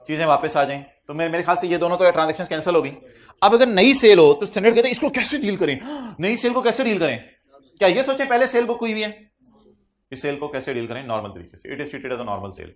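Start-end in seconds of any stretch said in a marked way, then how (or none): 3.4-9.3 mocking student.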